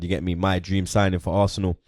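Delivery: ordinary delivery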